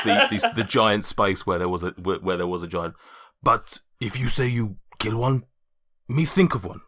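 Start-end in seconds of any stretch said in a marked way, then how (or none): 3.4-6.8 a hint of a gruff half-orc, broken speech; lightly voiced brute talking in simple words. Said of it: "tries to desperately remember what her voice was like".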